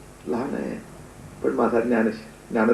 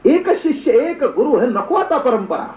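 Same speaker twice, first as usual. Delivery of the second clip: high, drawn-out acted objection; impassioned protest; 9 semitones higher, slower than usual. Disciple urges guru to drop the tradition, protesting passionately. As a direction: argue against the rule with soaring pitch. Acted argument against the old rule.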